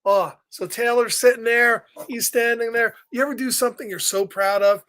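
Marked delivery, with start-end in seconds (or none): none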